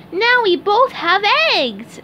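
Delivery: high, loud cartoon cheer; cheerful SpongeBob. A narrator's reading. SpongeBob is pleased both friends have eggs, cheerful. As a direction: share the good news, high and loud. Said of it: "said SpongeBob".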